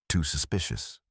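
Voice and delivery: plain reading voice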